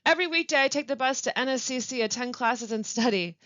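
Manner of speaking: rushed through with no pauses, fast; all run together. Shown as a demonstration. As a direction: blur it into one breath, quickly. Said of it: "If I had said it all together".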